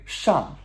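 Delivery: plunge the pitch on "Shang"; descending tone. Shown as a demonstration. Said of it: "descending tone".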